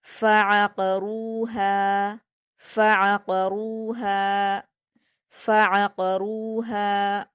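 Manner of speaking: फ़अक़रूहा recited slowly, short फ़, then ain from the throat; separate throaty ain. Shown as a demonstration. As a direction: split फ़ from the ain, hold रू and हा in फ़अक़रूहा. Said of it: "फ़ा और आइन दोनों के मखरज को अलग-अलग करके अदा करना".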